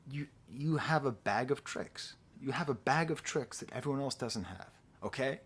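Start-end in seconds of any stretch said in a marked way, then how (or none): none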